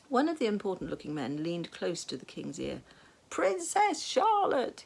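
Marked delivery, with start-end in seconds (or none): 3.3-4.8 very high, loud, slow shout into the ear. A narrator's reading. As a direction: bellow the name into a deaf king's ear. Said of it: "he shouted so loudly".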